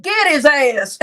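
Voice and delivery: put-on loud yell with a heavy country accent; hammed-up country holler of "Get his ass"; extra country. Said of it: "I put a little extra country on it".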